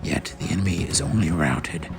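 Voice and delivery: ordinary delivery, nothing marked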